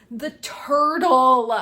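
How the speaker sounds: slow, loud, drawn-out turtle; longing emphasis. Real, not acted. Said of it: "because I just".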